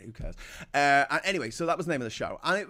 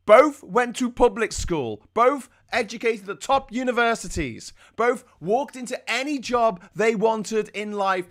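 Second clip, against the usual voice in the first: loud, raised, slow and emphatic outrage; indignant rant; 6 semitones higher, 6 dB louder than usual. Real, not acted. Vlogger on two born-rich multimillionaires, loud indignant rant. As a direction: hammer each point in real indignation.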